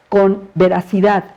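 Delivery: ordinary delivery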